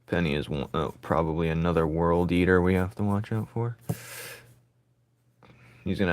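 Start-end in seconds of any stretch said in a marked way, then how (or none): none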